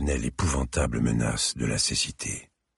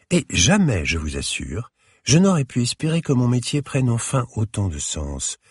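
put-on elegant older lady; 6 semitones higher than usual. A narrator's reading. Curator speaks warmly at length.

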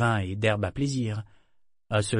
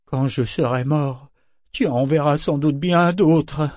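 put-on smug, slow childish gloat; childish satisfaction; 4 semitones higher, 6 dB louder than usual. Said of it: "avec une satisfaction puérile".

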